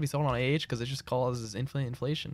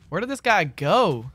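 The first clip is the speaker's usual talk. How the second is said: louder, higher, drawn-out bafflement; baffled outcry; 4 semitones higher, 6 dB louder, slower than usual. Real, not acted. Gamer hunting a vanished duel opponent, baffled outcry. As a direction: stretch it out in loud disbelief.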